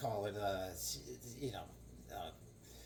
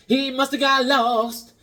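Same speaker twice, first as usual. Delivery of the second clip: dramatic; 10 semitones higher, 7 dB louder, slower than usual. Acted. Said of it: "repeats title dramatically".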